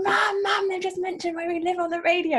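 high-pitched excited shout to mum; excited child calling out. Retold or imitated. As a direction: re-enact a thrilled kid calling for mum. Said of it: "I remember leaping out of bed and saying".

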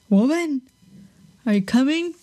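mock-gruff summons, rising at the end; Bluebeard calling. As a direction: holler up the stairs for your wife. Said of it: "Bluebeard was like".

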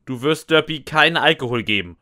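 usual speaking voice

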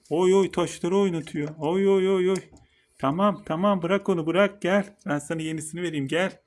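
high, loud fussing at the turtle; excited pet talk. Real, not acted. Turtle plays with stones.

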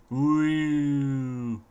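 long sustained swooping woo; playful falling woo. Pretend. Player testing a fall, long playful woo. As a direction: hoot all the way down. Test drop to check fall damage.

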